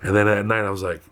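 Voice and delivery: ordinary delivery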